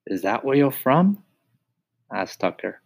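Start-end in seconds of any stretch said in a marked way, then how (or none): 0.1-1.2 lightly voiced mouse. Tucker asks.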